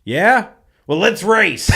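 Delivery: high, shouted dare; acted-out cocky shout; loud mock challenge. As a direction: yell the dare across to another car. Retold challenge to a street race.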